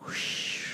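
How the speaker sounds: breathy wind whoosh. Acted. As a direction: blow like the wind. Wind sound in song.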